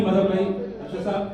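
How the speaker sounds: usual speaking voice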